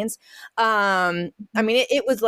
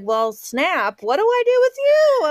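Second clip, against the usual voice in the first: high squeaky cry with a laugh; a giggly high-pitched cry at the cheese; mock exasperated cheese talk; 12 semitones higher, 3 dB louder than usual. - to a failed cheese, a high laughing cry. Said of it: "and I was like".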